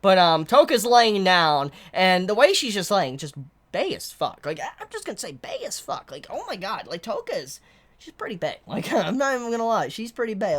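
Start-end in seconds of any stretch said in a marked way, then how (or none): 3.2-10.6 soft gushing. Real, not acted. Soft gushing about Toka.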